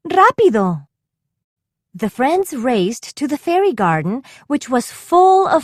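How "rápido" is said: higher, louder hurry-up shout; urgent call. A narrator's reading. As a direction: urge them to run, fast. Urging friends to hurry.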